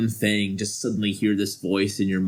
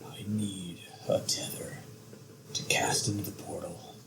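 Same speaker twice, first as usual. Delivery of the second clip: softer, hushed villain voice; menacing whisper; 10 dB quieter than usual. Acted. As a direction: breathe the threat quietly.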